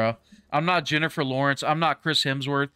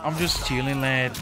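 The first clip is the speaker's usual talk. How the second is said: put-on Aussie accent; 3 semitones higher, 4 dB quieter than usual. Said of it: "That sounds like [name] again".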